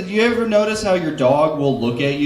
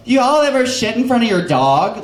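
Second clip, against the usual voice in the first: a hint of camp character voice; Dontrell; 7 semitones higher than usual.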